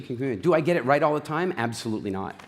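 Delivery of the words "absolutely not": ordinary talk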